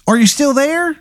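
high, hammy Baltimore "hun" voice; the worst Maryland accent you've ever heard; bad Maryland accent. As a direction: play a ghost with a terrible Maryland accent, way up high. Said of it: "Yeah, and he goes".

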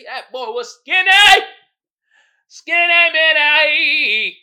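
high, loud sing-song hype; loud excited sing-song; excited shout. Real, not acted. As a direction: belt it out in disbelief. Hyped reaction to skinny actor.

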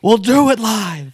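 Bill O'Reilly shout. Retold or imitated.

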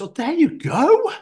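high, bright encouraging therapist; cheery therapist. Retold or imitated.

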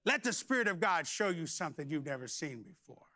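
usual speaking voice

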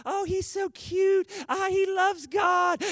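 high, drawn-out mock swoon; gushing infatuated admirer. Preacher mocking what smitten church members thought, gushing high. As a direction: gush dreamily in a high, slow voice. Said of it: "and you thought".

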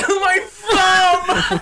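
loud, very high fake crying; hammed-up sobbing whine; mock wailing. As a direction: wail it like a big baby. Mocking Pat crying over thumb.

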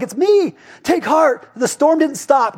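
high, bright, emphatic call over the storm; Jesus calling to the disciples, high and emphatic; voicing Jesus. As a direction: lift the voice high to reach them. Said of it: "So Jesus is like".

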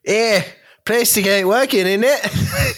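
put-on London lad British accent; hammed-up British street accent; mock British accent. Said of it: "problems the British had with credit cards".

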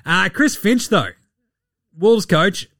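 ordinary delivery

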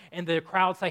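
usual speaking voice

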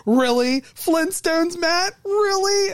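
high, rising mock disbelief; exasperated disbelief. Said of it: "The character looked at me and he goes".

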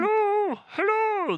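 high hollow voice echoing down a pipe; through a tube. Pretend. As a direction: talk as if through a long tube. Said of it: "This is my through a tube voice".

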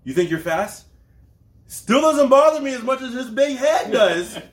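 hammed-up superhero taunt through laughter; Superman taunting. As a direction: sneer at the Flash as Superman. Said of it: "He says".

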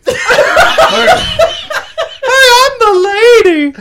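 the lady from Stargate. Retold or imitated. Doing the Stargate cast, the flirty lady. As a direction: squeal it like a flirty heroine. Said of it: "The cast of Stargate Universe".

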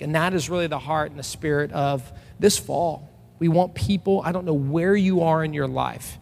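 ordinary talk

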